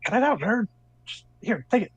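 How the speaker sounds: a hint of an annoyed older sister; bossy big sister. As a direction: shoo away the little brother. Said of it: "she goes".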